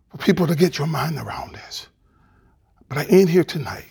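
soft breathy whisper; whispering to the congregation; hushed. Real, not acted. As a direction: lean in and whisper it. Preacher drops to hush.

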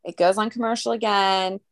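ordinary delivery

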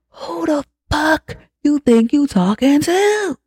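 slow, very high mock-furious outburst; hammed-up fury, drawn out and climbing; acted losing it completely. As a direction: stretch the words out in escalating imitated outrage. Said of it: "Yeah, she lost her shit".

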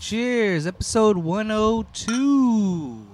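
celebratory announcement. Real, not acted. Host toasting the new episode, drawn-out announcement. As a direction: call it out and hold the ending.

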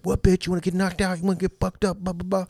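high mock thug taunt; mock tough guy. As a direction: ham up the meathead threatening. Aggressor threatens, mocked.

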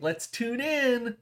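high, slow, singsong stores; drawn-out high chirpy voice; high slow sing-song. Acted. Voicing the stores.

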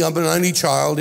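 normal talking voice

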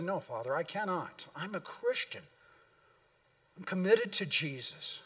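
soft, resolute daughter; a calm daughter refusing her father, said softly; quiet firm reply. Retold or imitated. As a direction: answer softly but without giving an inch. Perpetua answering her father calmly.

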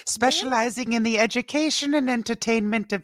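high-pitched nanny character voice; movie quote in a put-on high voice; Missus Doubtfire. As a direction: pitch it way up as a fussy film nanny. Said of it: "This is a callback to Missus Doubtfire".

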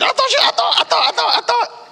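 very high, loud flustered stammer; panicked stammer. Retold or imitated. Parent caught out stammers excuses, very high and loud. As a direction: stumble over the excuse in panic.

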